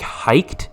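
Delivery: Hiked with a long "i", like "biked"; Hiked pronunciation. Shown as a demonstration. Gamer queries a player's surname, saying Hiked with long "i". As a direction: say Hiked with an "eye" vowel. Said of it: "I've heard it pronounced Hiked".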